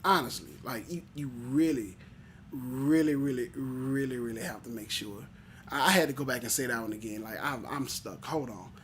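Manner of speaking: low, quiet self-talk; low emphatic aside. Real, not acted. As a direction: drop right down into a hushed, low rumination. Repeats himself in a low aside.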